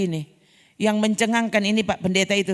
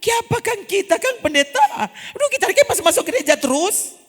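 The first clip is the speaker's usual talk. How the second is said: mock whining complaint to the pastor; whiny complaint; 8 semitones higher, 4 dB louder than usual. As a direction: moan like a church member who feels cheated. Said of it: "dia bukan bilang begini".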